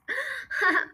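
brief high chuckle; short laugh. Real, not acted. Reader amused by the story's little actress, short chuckle.

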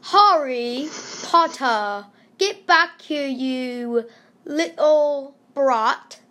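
slow, drawn-out mock British accent; exaggerated British, lingering on each word. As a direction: put on a hammy British accent, stretching the words. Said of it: "I dare you to do a British accent".

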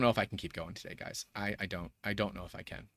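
very soft, low and halting; defeated. Real, not acted. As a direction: sink into near-silent doubt, voice dropped. Near giving up on stream.

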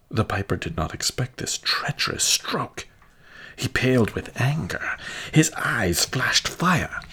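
ordinary delivery

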